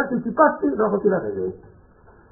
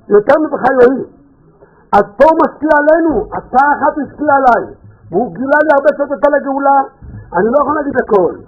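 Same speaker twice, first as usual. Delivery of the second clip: louder, higher, fervent sermon voice; preaching; 7 semitones higher, 6 dB louder than usual. Acted. Urging listeners to cry out for redemption, preaching at full force. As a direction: rouse the crowd to demand redemption.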